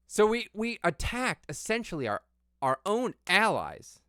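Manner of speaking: high, loud disbelief; exasperated rant, voice up high; outraged. Real, not acted. Outraged rant about allies.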